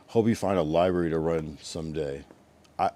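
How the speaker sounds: ordinary delivery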